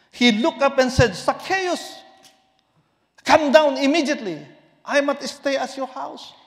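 loud, high calling sermon voice; fervent preaching. Acted. Preacher voicing Jesus calling Zacchaeus, loud and ringing. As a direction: proclaim Jesus's call to come down.

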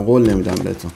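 normal talking voice

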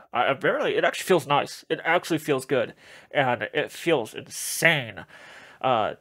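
muffled inside rubber mask; full head mask on; through a face mask. Real, not acted. Seen 3: Full-head alien mask covers the speaker's face during all labelled words.

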